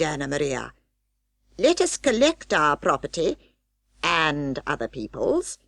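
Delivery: put-on pompous old rat, a touch alarmed; lightly voiced fussy rat giving orders; Samuel Whiskers voice. Old rat telling his wife they must flee, pompous and hurried.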